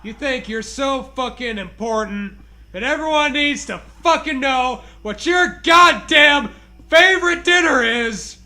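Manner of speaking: ordinary talk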